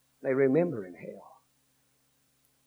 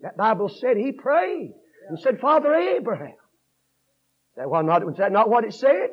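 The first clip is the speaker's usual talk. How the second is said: raised sermon voice climbing to a shouted peak; fervent preaching; 8 semitones higher, 11 dB louder than usual. Real, not acted. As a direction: drive up to a high cry, then ease. Preaches at full force.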